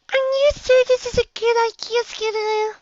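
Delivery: high, unsure little filly; timid young filly asking. A narrator's reading.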